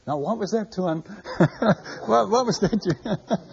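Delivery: asking through laughter, slowly; mocking the puzzled old man while laughing; laughing imitation. Old man stalling, narrator laughing.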